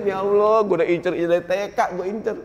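hammed-up heartbroken wail; mock crying. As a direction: pretend to weep over a lost crush.

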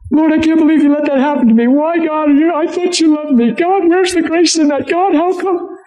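high, wailing mock prayer; accusing complaint to God. Sufferer questioning God after a tragedy, accusing complaint. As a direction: cry out to God in high-pitched, hurt protest. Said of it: "Do you hear where our language goes".

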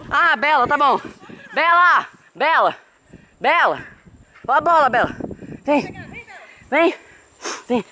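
high, bright pet-calling voice; calling the dog. Real, not acted. Owner calling her dog Bela to come play, in a high coaxing voice. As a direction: coax the dog over in a high sing-song.

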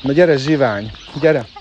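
loud calling to the flock. Real, not acted. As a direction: call the birds in loudly. Calling the birds inside. (hangos hívás)

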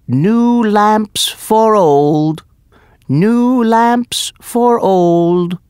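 street seller's loud, high, drawn-out cry; calling out. A narrator's reading. Magician hawking lamps near the palace, calling out. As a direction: call it out slowly like a hawker, high and loud. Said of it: "called out".

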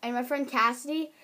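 normal talking voice

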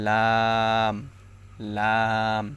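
long, held লাম with tongue edge by upper teeth; slow demonstration of লাম from the tongue's edge; tongue-edge letter. Shown as a demonstration. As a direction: draw লাম out, tongue-tip edge at the tooth roots. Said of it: "যে কোনো পাশ থেকে উচ্চারণটা".

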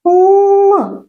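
Л held long on a high, steady pitch; drawn-out L. Shown as a demonstration. Teacher leads children saying the sound together, drawn-out Л. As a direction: stretch Л out as one sustained sound. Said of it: "Давайте разом вимовимо цей".

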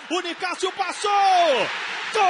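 building to a goal yell; goal shout. Real, not acted. Goal scored, commentator erupts.

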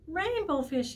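high call after him; little blue fish calling. Acted. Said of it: "he called".

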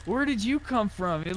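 normal talking voice

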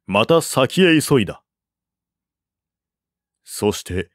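ordinary delivery, nothing marked

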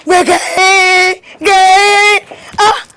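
high, loud, drawn-out cheer; long celebratory cry; jubilant whooping. Acted. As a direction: whoop it out in triumph. Rejoicing over good news.

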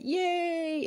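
a delighted high cheer; cheering yay. Retold or imitated. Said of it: "She's gonna be like".

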